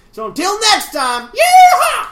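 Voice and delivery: loud, high hillbilly sign-off with a whoop. Acted. Host closing a listener's email in character, whooping hillbilly accent. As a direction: shout a hammed-up hillbilly farewell. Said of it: "part hillbilly".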